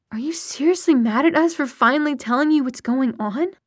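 indignant, disbelieving question; incredulous protest. A narrator's reading. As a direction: protest as if it's absurd. Daughter outraged at mother's reaction.

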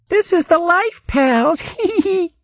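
sing-song jeer ending in giggles; mocking imitation. A narrator's reading. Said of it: "mocking imitation of the hogmaid's voice".

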